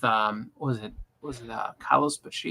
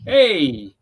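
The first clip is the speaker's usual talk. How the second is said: taunting greeting; 6 semitones higher, 3 dB louder, much slower than usual. Retold or imitated. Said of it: "That's like, you know".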